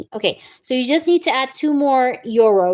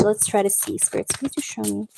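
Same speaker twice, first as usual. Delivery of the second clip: softer, hushed call to the spirits; spirit invocation; 3 semitones lower, 8 dB quieter than usual. Acted. Tarot reader calling on spirits for the truth, hushed.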